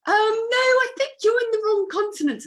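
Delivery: very high, loud imitated correction; exaggerated squeaky-high awkward reply; mock polite correction. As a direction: correct someone in a hammed-up, high-pitched voice. Acted correction of air hostesses.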